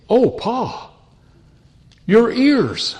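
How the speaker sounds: put-on high, slow, sweet little girl voice, amazed; a sweet granddaughter gasping at Pa's singed face. Granddaughter to burnt grandpa, high and amazed.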